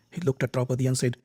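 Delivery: ordinary delivery, nothing marked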